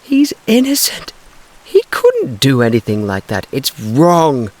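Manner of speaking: very high, loud protest; anguished protest. Acted. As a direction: cry out against injustice. Tessie protesting father's arrest.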